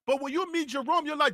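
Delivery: ordinary talk, nothing marked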